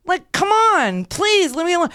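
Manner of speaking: high-pitched, pestered pleading; exasperated plea. Retold or imitated. Patient in a gown to a pestering fan, exasperated plea. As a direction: beg to be left alone, pitched right up.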